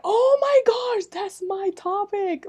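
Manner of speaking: high, slow, thrilled exclamation; excited gasp. Retold or imitated. Retelling to the listeners how she found his talk, with thrilled excitement. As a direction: squeal it out like you just found your dream topic. Excited retold reaction to discovery.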